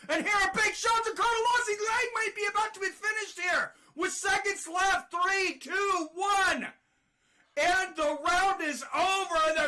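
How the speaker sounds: fast, high, shouted countdown to the bell; frantic call. Real, not acted. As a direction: race the clock as the finish nears. Final seconds, finish looming.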